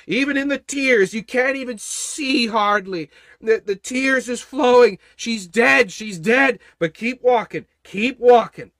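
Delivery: loud, high preaching that builds; full-force preaching. Acted. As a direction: preach it hard to the crowd.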